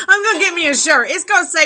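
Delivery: can barely get the words out for laughing; laughing while talking. Real, not acted. Pitching a joke t-shirt to viewers, laughing loud and high.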